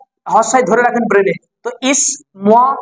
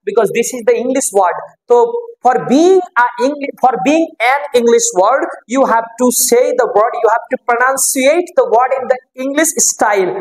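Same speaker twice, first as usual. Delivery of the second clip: very slow English explanation; much slower than usual. Shown as a demonstration. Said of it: "teacher explains English pronunciation slowly".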